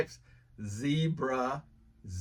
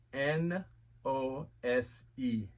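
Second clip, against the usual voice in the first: N O S E spelled slowly, letter by letter; slow spelling; 3 dB quieter, slower than usual. Shown as a demonstration. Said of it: "spelled out letter by letter".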